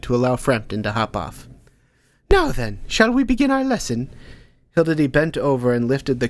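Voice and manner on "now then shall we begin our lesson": put-on higher old woman's voice, lifting brightly; a teacher opening class; brisk old teacher. Hilda Dee starting Ank's lesson, brisk and high.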